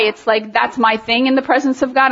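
normal talking voice

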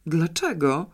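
mildly puzzled why; a touch of astonishment; surprised question. A narrator's reading. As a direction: ask in innocent surprise. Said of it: "zdziwiła się Janeczka".

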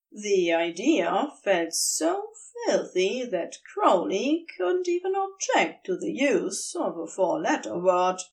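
usual speaking voice

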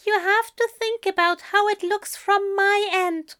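high-pitched, pleading angel voice; earnest, high-voiced reproach; hurt angel.

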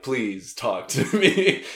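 slow, drawn-out plea with a laugh. Retold or imitated. Comic asks audience to talk to her, slow laughing plea. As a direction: beg slowly, laughing. Reversing her onstage joke.

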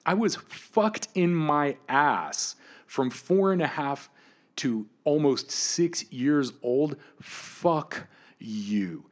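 furious, slow testimony; raw rage. Real, not acted. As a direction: hammer out the words in fury. Rage recounting childhood abuse.

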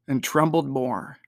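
ordinary delivery

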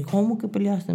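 imitated dad's startled, high-pitched question; mock a father's disapproving disbelief; high, half-cross retold challenge; mildly angry dad. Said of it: "Y medio se enojó mi papá".

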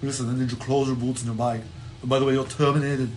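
put-on lightly Austrian-accented Arnold voice, fast at the end; Arnold impression. Host playing Arnold as the new boss firing people, Austrian accent. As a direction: rattle it off as Arnold firing someone. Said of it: "Arnold became the new guy".

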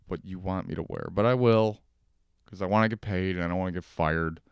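normal talking voice

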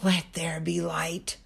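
slow whisper of God's voice calling into the dark. Acted. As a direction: breathe it out softly, like a voice in the dark. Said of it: "He's still calling out into our darkness".

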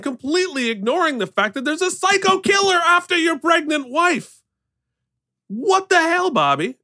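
loud, high exasperated outburst; ranting in disbelief. Real, not acted. Ranting about the husband.

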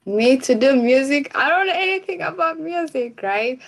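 high, fast squeal of disbelief; shocked disbelief. Retold or imitated. Retelling her shock when given the music topic, high squeal. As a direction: act stunned at being handed the topic. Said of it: "I was like".

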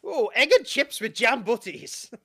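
high, loud, slow British caricature; mock British accent. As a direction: ham up a delighted British cafe regular.

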